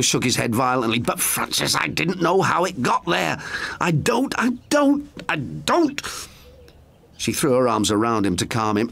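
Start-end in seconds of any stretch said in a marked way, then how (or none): 1.0-6.0 distraught. A narrator's reading. Daniel distraught, shaking head.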